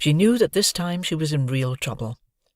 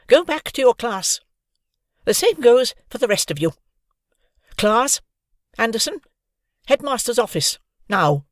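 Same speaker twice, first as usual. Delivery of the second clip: high, loud commanding teacher; stern orders; 8 semitones higher, 4 dB louder than usual. Acted. Deputy headmistress sending everyone away, loud and stern. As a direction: dismiss the crowd firmly.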